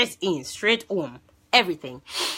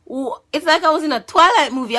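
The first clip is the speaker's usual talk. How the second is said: excited; 9 semitones higher, 8 dB louder, faster than usual. Real, not acted. Excited retelling, loud and fast.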